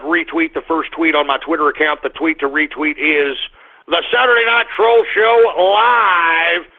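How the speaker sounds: ordinary talk, nothing marked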